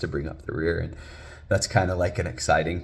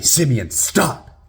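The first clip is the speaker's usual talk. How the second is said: loud, higher urgent command; loud yell; 8 semitones higher, 8 dB louder, much slower than usual. Retold or imitated. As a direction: yell a drawn-out warning to your child. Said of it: "I yell out pretty loudly".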